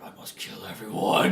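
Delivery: whispered, slow and menacing; mock whisper. Young gamer's inner voice, a slow sinister whisper. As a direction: whisper it like a bloodthirsty kid. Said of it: "it was just like".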